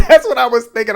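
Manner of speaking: high laughing squeal into the words. Real, not acted. Laughing to viewers about her wild guess. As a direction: crack up at your own joke.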